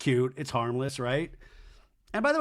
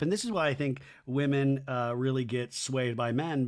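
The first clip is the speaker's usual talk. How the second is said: ordinary talk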